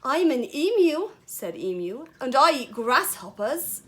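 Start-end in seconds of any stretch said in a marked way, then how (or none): 0.0-1.1 put-on light Australian accent, high and loud; storybook emu with a hint of Australian; attempted Australian accent. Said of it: "I tried to do my Australian accent". 2.2-3.8 lightly Australian emu voice, louder and higher; a touch of Australian, projected; loud storybook emu with slight Australian accent; attempted Australian accent. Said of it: "I tried to do my Australian accent".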